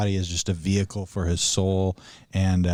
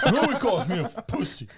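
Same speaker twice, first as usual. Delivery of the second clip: high, fast, laughing outrage; hammed-up wounded indignation; mock pissed off; 11 semitones higher, faster than usual. Podcaster retells raging at an online stranger, mock pissed off. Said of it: "I was so pissed".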